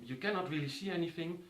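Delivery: ordinary delivery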